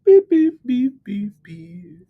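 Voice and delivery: high beeps falling in pitch and fading; imitated beeps. Streamer beeping to themselves while sharing the live, playful electronic beeps.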